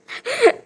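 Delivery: high giddy laugh near tears; a real, breathless burst of laughter. Real, not acted. Laughter between takes.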